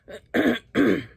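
clearing pepper powder from the throat. Real, not acted. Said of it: "I'm clearing my throat so much".

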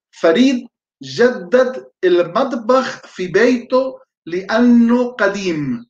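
slow, careful dictation of an Arabic sentence. Shown as a demonstration. Teacher dictates a sentence for students to translate, slowly. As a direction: spell it out word by word for note-takers. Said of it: "con atención y con rapidez puedan ustedes traducir".

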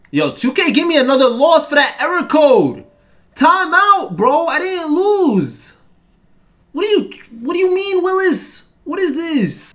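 high, loud indignant rant; outraged yelling. Real, not acted. Gamer protesting a loss from an error code, outraged yell. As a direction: yell in disbelief at being cheated.

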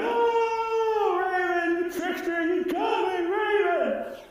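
high, loud anguished scream. A narrator's reading. Tide Woman screaming at Raven after being blinded. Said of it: "she was screaming".

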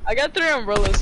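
ordinary talk

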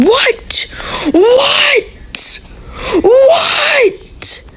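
high, squealed what; mock disbelief. Gamer baffled mid-intro by what happens, high squealed disbelief.

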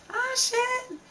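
high yelp of surprise; surprised. Real, not acted. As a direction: blurt it out high and startled. Sudden exclamation.

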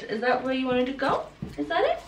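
squeaky praising a dog. Real, not acted. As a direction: coo questions at the dog. Asking the puppy.

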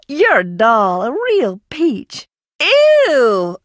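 ordinary talk, nothing marked

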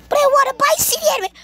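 very high, rushed pleading voice; mock pleading kid. Sent to the store, begs for anime, high mock plea. Acts whining reply to an order.

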